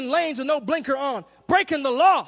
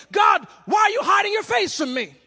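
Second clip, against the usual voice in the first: loud, higher anguished cry; 6 semitones higher, 5 dB louder than usual. Retold or imitated. David crying out to God, loud and high. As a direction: call out to God in protest. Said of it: "David was like".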